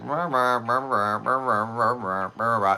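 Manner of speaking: flat, one-note rah rah; monotone drone. Shown as a demonstration. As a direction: drone on without any rise or fall. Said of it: "People are one note".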